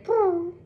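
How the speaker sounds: high mock pigeon boo; light pigeon call up high; pigeon coo. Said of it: "They say".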